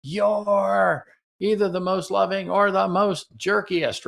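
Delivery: mild mock criticism. Shown as a demonstration. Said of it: "You have the criticism".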